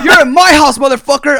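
high, very loud territorial yell; hammed-up furious shout; imitated irate kitchen guy. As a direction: roar it like the boss of the kitchen. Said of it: "The kitchen guy goes".

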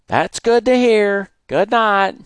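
high, loud, slow call; neighbour yelling. Acted. Neighbour calling good night across yards. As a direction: call it slowly from afar. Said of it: "Brother Nelson yell".

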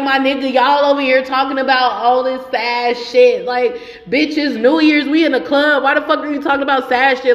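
irritated. Retold or imitated. Retelling a rant at friends in the club on New Year's, irritated. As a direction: push the pitch up, scolding in disbelief. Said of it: "I was so irritated 'cause I'm like".

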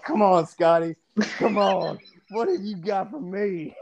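mock coaxing. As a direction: egg him on, playfully pleading.

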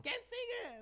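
high squeaky woman asking who's next; mock prostitute voice. Retelling the other prostitute's offer, in a high mock voice. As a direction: pitch way up, playful offer. Repeats prostitute's line, pitched up.

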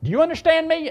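high, loud angry demand; mad. Retold or imitated. Angry father threatening the preacher by phone, mad. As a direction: imitate a furious caller demanding an answer. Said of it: "And he was mad".

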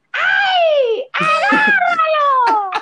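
very high, laughing, slow shrieks; mock screams. Acting out the people screaming in the street, laughing mock shrieks.

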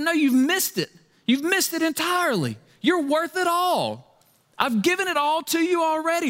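high, urgent pleading; high, pressing fatherly appeal. Acted.

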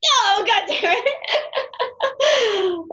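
slow, drawn-out laughing groan. Real, not acted. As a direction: groan it while cracking up. Reacts to the joke, laughing.

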